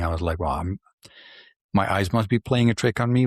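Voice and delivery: ordinary delivery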